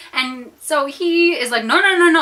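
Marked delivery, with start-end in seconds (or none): 1.6-2.2 louder, higher imitated refusal; firm insistence. Lifeguard insisting on helping the swimmer, emphatic. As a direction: override a protest with rapid repeated noes, retold.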